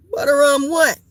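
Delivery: usual speaking voice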